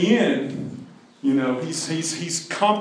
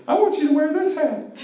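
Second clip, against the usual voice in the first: high girlish mock voice; playful girl's request, higher; pretty girl; 13 semitones higher than usual. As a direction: imitate a sweet girl asking, pitched up. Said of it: "a pretty girl would have come up to him and said".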